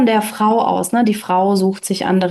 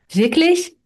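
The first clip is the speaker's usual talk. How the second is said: high, amazed question; a lightly voiced astonished boy; 7 semitones higher than usual. Hans asking Bertha in disbelief, a high astonished question. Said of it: "War Hans erstaunt".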